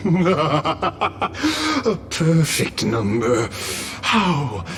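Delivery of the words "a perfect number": slow, high, laughing delivery; drawn-out mocking delight; gloating amusement. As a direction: laugh into the words, savouring them. Villain gloats over the count.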